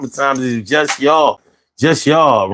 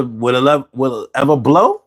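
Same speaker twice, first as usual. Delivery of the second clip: ordinary talk, nothing marked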